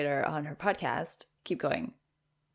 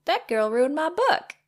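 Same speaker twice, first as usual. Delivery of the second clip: mock outrage; 9 semitones higher, 9 dB louder than usual. Mock outrage about book.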